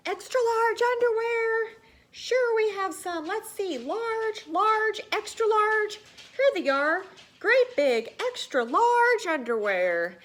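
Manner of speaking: high, loud bellowing shopkeeper voice; booming clerk who's half deaf from bell ringing; hard-of-hearing shout. Acted. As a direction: yell it like you can't hear yourself. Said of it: "practically scream just to hear himself talk".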